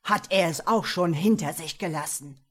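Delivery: loud, harsh devil question; impatient demand. A narrator's reading. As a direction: snap the question out. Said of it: "herrschte er nun ungestüm los".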